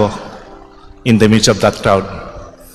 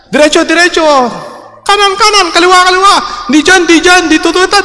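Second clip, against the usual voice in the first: shouted directions; faster than usual. Retold or imitated. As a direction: yell confusing directions at the blindfolded kid. Crowd shouting directions again.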